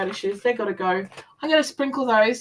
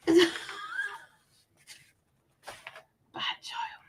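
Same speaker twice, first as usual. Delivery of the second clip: hushed giggly self-tease; whispered giggle; 8 dB quieter than usual. Real, not acted. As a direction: giggle it under your breath.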